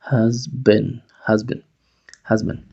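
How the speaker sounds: "Has been" with the vowel reduced to schwa. Shown as a demonstration. Teacher drilling the phrase, reduced schwa. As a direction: keep the vowel small and quick in "Has been". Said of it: "Se reduce esa A a un sonido schwa".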